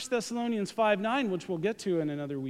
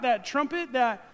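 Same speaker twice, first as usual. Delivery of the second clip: ordinary talk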